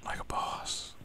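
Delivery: hushed gravel-free whisper. Pretend. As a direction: breathe it like a secret. Echoing Batman's whispered line.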